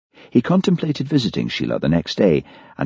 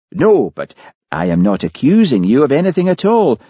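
high, swooping character voice; Hamish's coaxing questioning; 6 semitones higher than usual. A narrator's reading. Policeman placates the sour builder, high and coaxing. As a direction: coax and reassure in a raised, lilting pitch.

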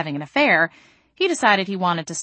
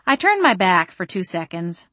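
plain reading voice

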